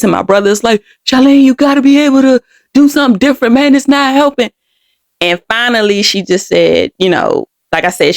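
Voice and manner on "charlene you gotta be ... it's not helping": higher, retold exasperated appeal; urgent plea. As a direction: plead urgently as the brother, mildly acted. Brother's retold plea to their mother.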